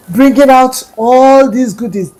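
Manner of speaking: ordinary delivery, nothing marked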